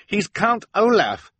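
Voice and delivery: high, slow, emphatic outcry; insistent cry. Acted. Klaus names the villain to the adults, crying out. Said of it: "Klaus cried".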